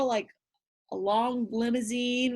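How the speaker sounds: plain reading voice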